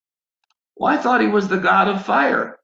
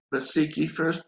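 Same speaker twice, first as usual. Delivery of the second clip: hushed, much softer inner voice; still small voice; 8 dB quieter than usual. Retold or imitated. The still small voice nudging the preacher, hushed and soft. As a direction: let it come in quietly, barely there. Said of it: "this still small voice comes again".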